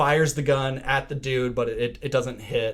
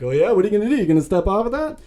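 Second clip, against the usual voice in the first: loud, high-pitched mocking challenge; loud, jeering retold dare; imitated taunt; 9 semitones higher, 6 dB louder, much faster than usual. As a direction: taunt him, pitched way up and louder. Voices hunter taunting trapped man.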